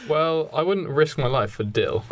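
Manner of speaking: ordinary delivery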